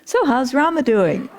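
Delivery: high, bright, laughing question; bright and joyful. Retold or imitated. Guru greeting his distraught disciple, joyfully bright. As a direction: ask it with cheerful delight. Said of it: "very brightly, joyfully says".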